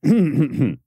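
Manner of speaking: quick throat clear before going on; brief cough to reset. Real, not acted. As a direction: clear the throat, then apologize. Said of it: "clears throat".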